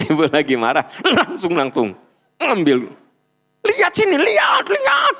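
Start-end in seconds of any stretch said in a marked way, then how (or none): 3.6-5.2 hammed-up shriek, far higher; angry mother yelling at her kid; mock scolding mother. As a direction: snap at the child to look here.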